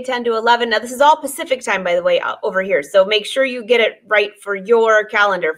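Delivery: ordinary talk, nothing marked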